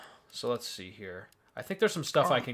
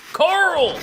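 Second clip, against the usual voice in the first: exasperated shout; 8 dB louder than usual. Acted. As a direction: shout the name, high and loud.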